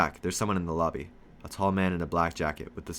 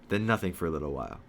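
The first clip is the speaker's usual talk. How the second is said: plain reading voice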